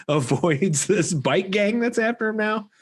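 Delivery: normal talking voice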